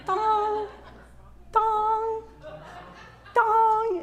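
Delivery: put-on high sung "dong" beeps like an old video game; ping each "dong" high like the Pong blips; high retro game bleeps.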